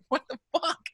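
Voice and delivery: disbelieving "What the fuck?" that leaps high at the end; shocked disbelief. Retold or imitated. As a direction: blurt it out in total shock, voice jumping up. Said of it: "I was like".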